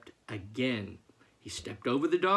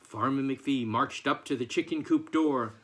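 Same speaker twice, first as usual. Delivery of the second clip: ordinary delivery, nothing marked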